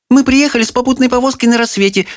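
rapid-fire chatter; too much caffeine. Acted. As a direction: rattle it off breathlessly fast. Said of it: "затараторила".

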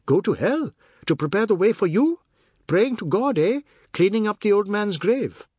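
high, loud jeering questions; angry taunting. A narrator's reading. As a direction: hurl each question as a taunt. Biswas taunts in shouted quarrel.

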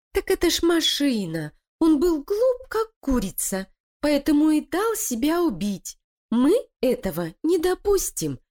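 high, sly voice of a plotting wife; scheming female voice. Acted. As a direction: persuade her partner, cold and cunning. Лилия строит коварный план. (коварная интриганка)

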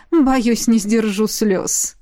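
slow, smiling gentle words; warm smile. A narrator's reading. Gorgani declines to watch the dance, warmly smiling. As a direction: say it kindly with a smile. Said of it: "тепло улыбнулась Горгани".

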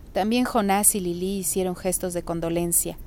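ordinary delivery

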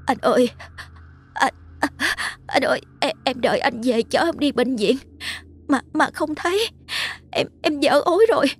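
very high, faint, broken pleading; weak, tearful voice. A narrator's reading. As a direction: gasp it out weakly in pain. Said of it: "giọng yếu ớt". (giọng yếu ớt, nghẹn ngào)

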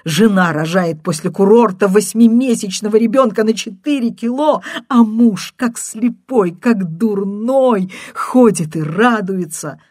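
distraught woman berating the husband; tearful outburst. A narrator's reading. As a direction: pour it out, close to tears. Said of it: "кажется, плача".

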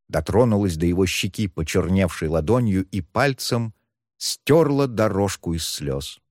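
ordinary delivery

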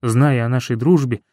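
usual speaking voice

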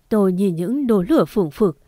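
plain reading voice

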